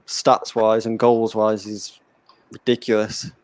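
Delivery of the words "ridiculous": ordinary talk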